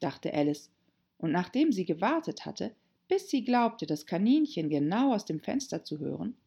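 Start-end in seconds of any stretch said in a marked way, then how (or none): none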